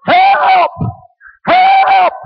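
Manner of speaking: retold panicked holler for help. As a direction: yelp it like a man treed by a bull. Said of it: "heard somebody hollering".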